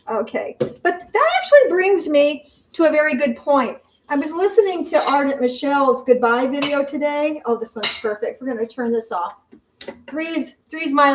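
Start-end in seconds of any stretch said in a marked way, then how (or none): none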